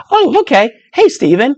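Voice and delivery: high, bright-sounding "Oh, okay"; a cheery, unbothered reply; breezy mock acceptance; easy acceptance. Acted correction accepted.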